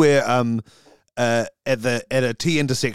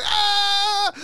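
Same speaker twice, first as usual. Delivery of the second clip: startled scream. Acted. Both scream together.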